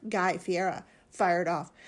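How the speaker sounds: plain reading voice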